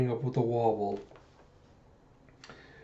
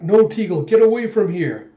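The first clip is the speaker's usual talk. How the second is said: high, loud plea to get clear; urgent warning shout; 7 semitones higher, 8 dB louder than usual. Retold or imitated. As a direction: shout a desperate warning to a friend. Acting Alita's urgent warning.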